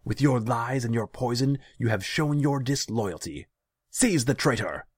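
high, loud public denunciation; ringing shouted command. A narrator's reading. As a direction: proclaim it to the whole room. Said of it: "He raised his voice to make sure everyone in the room could hear".

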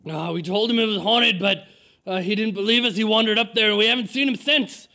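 put-on agitated elderly man calling out; screaming old man. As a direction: keep hollering the story like a worked-up villager. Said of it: "you overhear him screaming".

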